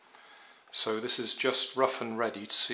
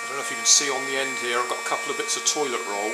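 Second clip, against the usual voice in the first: shouting over machinery; 13 semitones higher than usual. Real, not acted. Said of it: "if I shout over the noise".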